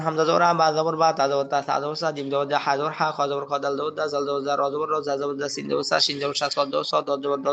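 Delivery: rapid-fire level recitation, all in one breath. Shown as a demonstration. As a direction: run the spelling drill together without pauses. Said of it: "আপনি এক নিঃশ্বাসে বলা". (এক নিঃশ্বাসে টানা বানান পড়া)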